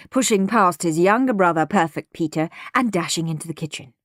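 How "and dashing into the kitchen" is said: usual speaking voice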